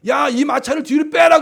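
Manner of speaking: high, loud imitated command; acted-out royal shout; king shouting orders. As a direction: yell to the driver to pull back. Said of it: "막 소리 지르니까".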